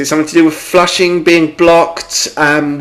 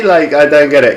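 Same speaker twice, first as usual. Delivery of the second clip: ordinary talk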